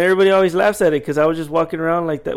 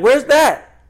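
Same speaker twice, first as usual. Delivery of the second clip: high, louder imitated customer question; baffled customer; 10 semitones higher, 7 dB louder than usual. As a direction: mimic a shopper pressing for directions. Retelling same customer exchange.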